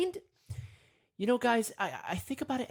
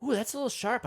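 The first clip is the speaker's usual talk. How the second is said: high, loud "oh" of mock pain; acted-out little ouch, pitched up; pricked and startled; 8 semitones higher, 3 dB louder than usual. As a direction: recoil from a sudden prick. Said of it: "it pricks you and you go".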